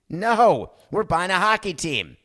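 loud, high-pitched brush-off; emphatic refusal. Acted. Team owners to free agent Noah, emphatic refusal. Acted owners rejecting the offer.

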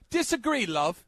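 high-pitched, hammed-up British accent. Host answering criticism of the accent, in mock British accent. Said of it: "Nothing like a British accent".

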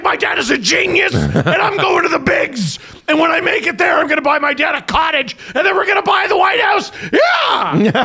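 put-on high, shouted kid bragging; boasting excited son. Dad imitating his son bragging after a hit, triumphant. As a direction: crow high and triumphant, building to a yell.

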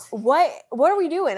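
high, alarmed what are we doing; panicked question. Retold or imitated. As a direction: plead with your husband in the waves. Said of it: "looking at John".

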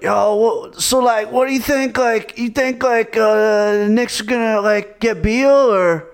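goofy, high put-on fan voice; dopey fan impression. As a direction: imitate a clueless Knicks fan asking. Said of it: "man, some of the people, they're like".

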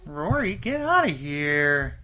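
loud, drawn-out shooing call; scolding the cat. Real, not acted. Host shooing the cat from the mic, loud scolding. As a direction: shoo the cat loudly, stretching the words.

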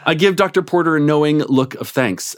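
plain reading voice

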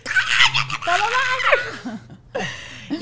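very high, strangled comic shriek; mock furious yell. Shown as a demonstration. Mother showing how she wishes she could talk, shrieking. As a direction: let the monster out in a shrill scream. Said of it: "como quisiera hablar ahorita".

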